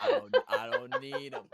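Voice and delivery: laughing through the refusal; giggling no thanks. Real, not acted.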